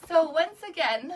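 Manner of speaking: high, tearful restart; crying. Real, not acted. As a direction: push through the tears, voice up high. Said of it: "I'm so crying so much".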